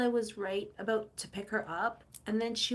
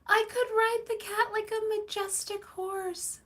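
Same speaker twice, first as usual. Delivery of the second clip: excited; 11 semitones higher, 3 dB louder than usual. Real, not acted. Delighted idea about riding cat.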